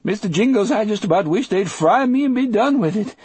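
high, quavering lament; Delacroix, trembling. A narrator's reading. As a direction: wail nervously in a very high voice. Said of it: "Delacroix said in a trembling voice".

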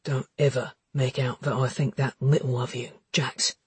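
solemn, cautioning man; grave warning. Acted. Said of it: "His tone was grave, cautioning".

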